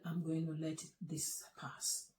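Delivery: whispered, quiet forgiveness. Retold or imitated. Wife quietly forgiving husband at breakfast, whispered. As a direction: breathe it out under the breath.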